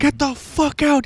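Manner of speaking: high, slow imitation of furious ordering-out; mock-angry worker throwing someone out; angry shop worker. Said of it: "he's like".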